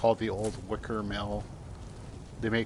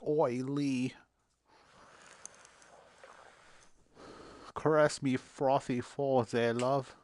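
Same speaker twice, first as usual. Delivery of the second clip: British accent impression; 2 semitones higher, 5 dB quieter than usual. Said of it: "my impression of British people".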